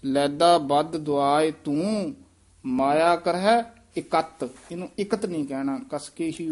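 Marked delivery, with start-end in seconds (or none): 0.0-4.5 slow, with every word of ਲੈਦਾ ਬਦ ਦੁਆਏ ਤੂੰ spaced out; deliberate, measured recitation; slow careful reading. Shown as a demonstration. Teacher models the whole line slowly for the student. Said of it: "ਧਿਆਨ ਨਾਲ ਉਚਾਰਨ ਕਰਨਾ ਹੈ".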